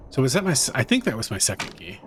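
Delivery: normal talking voice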